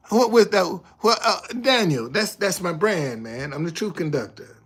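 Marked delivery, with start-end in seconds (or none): none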